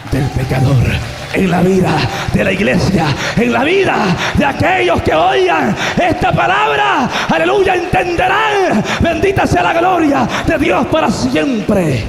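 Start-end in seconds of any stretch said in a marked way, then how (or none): 1.3-12.0 high, loud preacher's climax; preaching shout. Real, not acted. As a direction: cry it out to the whole congregation.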